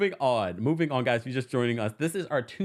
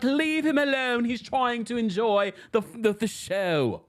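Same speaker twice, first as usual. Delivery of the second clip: put-on terrible British accent; 7 semitones higher than usual. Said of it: "that's a terrible accent".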